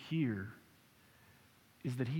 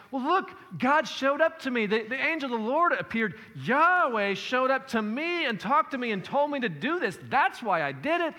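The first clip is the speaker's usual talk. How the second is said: insistent Gideon; 5 semitones higher, 4 dB louder than usual. Retold or imitated. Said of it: "if he says to the Ephraimites".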